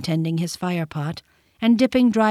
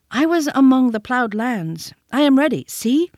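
higher, louder eager reply; eager boy; 6 semitones higher, 3 dB louder than usual. A narrator's reading. Mowgli showing fire pot to panther, eager. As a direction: show him ready and proud.

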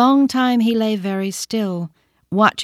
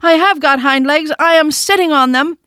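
much higher, louder protesting cry; cried out; 8 semitones higher, 7 dB louder than usual. A narrator's reading. Toy rabbit crying out to the wild rabbit, loud and high. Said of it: "cried the little rabbit".

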